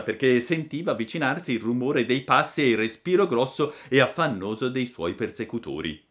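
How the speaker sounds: ordinary delivery, nothing marked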